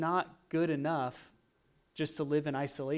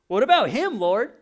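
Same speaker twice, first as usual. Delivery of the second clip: loud, indignant Peter; 8 semitones higher, 13 dB louder than usual. Retold or imitated. Peter questioning Jesus, retold.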